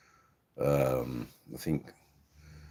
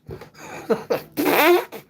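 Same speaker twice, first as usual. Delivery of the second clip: high giggle; 7 dB louder than usual. Real, not acted. Laughing at his own joke.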